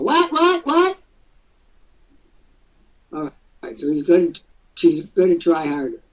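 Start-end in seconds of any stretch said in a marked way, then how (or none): 0.0-1.0 much higher, mock baffled what-what; mock mutter back. Boss mocks an employee muttering back, high and baffled. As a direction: squeak it back like a clueless employee. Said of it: "You mutter back to me and go".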